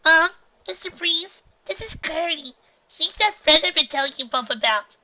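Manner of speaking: put-on nervous young girl; shy filly voice. A narrator's reading. Filly voice introducing friend.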